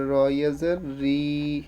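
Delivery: রি drawn out on a long i; stretched final vowel. Shown as a demonstration. Teacher telling pupils to stretch it, long held রি. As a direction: spell it out, then hold the i of রি. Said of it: "কি টান দিয়ে পড়তে হবে".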